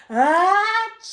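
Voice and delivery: loud, high hammed-up sneeze; one big sudden achoo; bear sneeze. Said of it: "makes the bear say".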